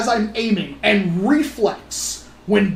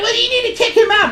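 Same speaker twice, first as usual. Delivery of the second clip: high, fast mock demand; demanding mom; 12 semitones higher, 3 dB louder, much faster than usual. The mom demanding the owner kick him out, high fast mock. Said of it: "she was like".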